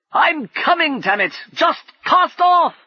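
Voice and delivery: very high, loud, slow insistent shout; urgent yell. Acted. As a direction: force them to leave now. Brumman urging departure.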